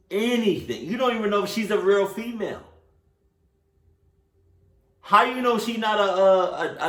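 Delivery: loud, high-pitched indignant challenge; pissed rant. Real, not acted. Viewer lecturing the story's hero about the stranger, pissed rant. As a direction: fire off accusing questions with the pitch way up. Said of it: "this is why I'm pissed".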